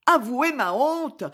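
cry out. Acted. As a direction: cry it out in disbelief. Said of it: "s'écria-t-elle". (elle s'écrie, plus aiguë, honteuse)

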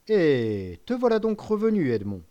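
put-on loud, high greeting in a Marseille accent. Caderousse greets returning Edmond in a strong Marseille accent. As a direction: hail him in broad Marseille accent. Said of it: "avec un accent marseillais des plus prononcés".

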